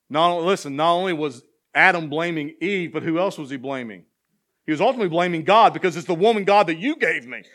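fervent sermon cadence, climbing in pitch; full-force preaching. Acted. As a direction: preach the psalm out to the back row. Building to Adam's blame.

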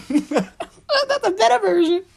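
loud, high cackle through the words; laughing hard. Real, not acted. Bursts out laughing.

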